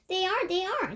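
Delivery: high, loud chorus. A narrator's reading. English witches chorusing agreement, high pitched. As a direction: squeal it back as a crowd. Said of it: "chorused the English witches".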